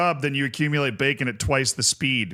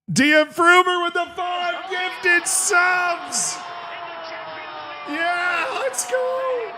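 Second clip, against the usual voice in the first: high, slow hype shout; excited shout; 12 semitones higher, much slower than usual. Real, not acted. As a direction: yell it like a goal call. Celebrating five gifted subs.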